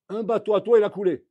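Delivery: loud, high-pitched acted bombshell; spring the news on him; sudden announcement. Rabbi voicing the Rav testing his student, sudden loud announcement.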